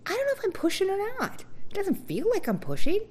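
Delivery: very high, fast, fretful voice; flustered confusion. Retold or imitated. To the nurses while pushing, very high and flustered. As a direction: squeak out the confusion. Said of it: "And I was like".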